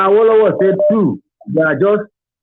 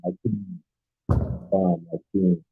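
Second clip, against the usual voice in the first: faint and far from the mic; weak, distant phone line voice; barely audible; 11 semitones lower, 11 dB quieter than usual. Real, not acted. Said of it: "Your voice is very low".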